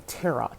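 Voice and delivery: TAROT said "TAIR-ot", T sounded; pronounced final T. Shown as a demonstration. Vlogger confessing an old habit, TAROT with a sounded T. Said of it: "I pronounced the T in tarot".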